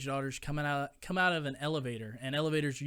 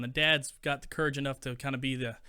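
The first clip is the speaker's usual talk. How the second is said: ordinary talk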